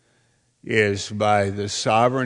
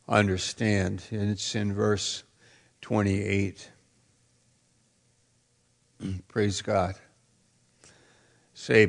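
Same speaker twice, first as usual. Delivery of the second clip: ordinary talk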